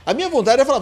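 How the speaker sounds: ordinary talk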